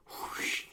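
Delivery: breathy whoosh of pouring rain; whispered whoosh. Retold or imitated. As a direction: whisper a rushing rain sound. Imitating the rain noise.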